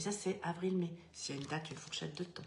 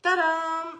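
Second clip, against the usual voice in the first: excited fanfare; 9 semitones higher, 11 dB louder than usual. Real, not acted. Prize draw reveal fanfare.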